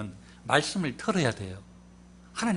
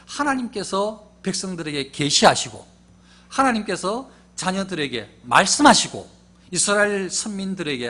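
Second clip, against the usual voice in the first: louder, slow, emphatic pulpit delivery; stirring sermon build; full-force preaching; 3 semitones higher, 8 dB louder than usual. Acted. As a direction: drive each phrase home.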